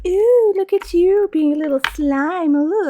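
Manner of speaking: high, loud teasing coo; mocking boyfriend. Boyfriend teasing the streamer, mocking. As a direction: make fun of her with an exaggerated ooh. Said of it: "he makes fun of me for stuff".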